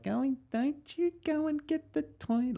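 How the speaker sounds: put-on very high, cooing baby talk. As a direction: mimic someone cooing at a small child. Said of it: "when people talk baby to their kids".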